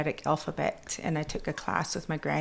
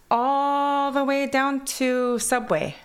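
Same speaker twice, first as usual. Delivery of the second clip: slow, higher English in a Kwakwala accent; a Kwakwala accent, slow and playful; 9 semitones higher, 4 dB louder, slower than usual. Shown as a demonstration. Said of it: "my accent from Kwakwala goes into English".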